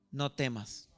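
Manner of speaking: low, hushed "No temas", gently let fall; soft reassurance. Retold or imitated. King David calming the terrified Mefiboset, soft and low. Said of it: "le dijo".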